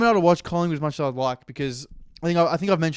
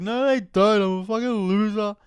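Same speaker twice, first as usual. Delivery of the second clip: self-pitying coach; 6 semitones higher than usual. Retold or imitated. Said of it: "And Nathan's like".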